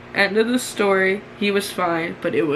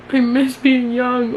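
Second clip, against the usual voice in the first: slow, louder wistful sigh of a line; 5 semitones higher, 5 dB louder, slower than usual. Real, not acted. Nostalgic outburst at old entry.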